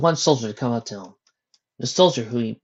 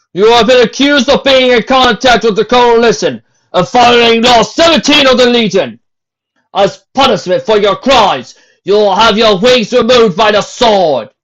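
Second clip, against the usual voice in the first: stern captain; 11 semitones higher, 13 dB louder than usual. A narrator's reading. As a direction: lay down the law to the crowd. Captain sentences the mare.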